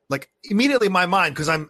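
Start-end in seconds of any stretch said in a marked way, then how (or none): none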